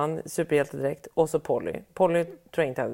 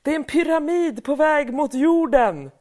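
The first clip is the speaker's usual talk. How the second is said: imitating excited child; 10 semitones higher than usual. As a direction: shout it like a breathless child. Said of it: "hon skriker". (barnet ropar upphetsat till mamma)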